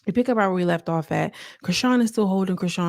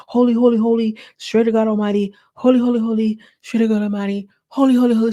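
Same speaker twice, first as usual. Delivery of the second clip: raised, louder imitation of fervent prayer; mock praying; 4 semitones higher, 4 dB louder, faster than usual. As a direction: mimic a woman chanting her prayer. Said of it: "so she starts praying".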